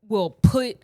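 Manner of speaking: ordinary delivery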